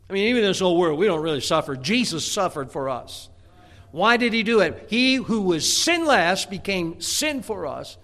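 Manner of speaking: loud, high preaching, slowing on the last line; full-force preaching. Acted. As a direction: build to a thundering pulpit cry.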